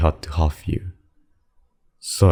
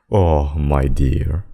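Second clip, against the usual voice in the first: ordinary talk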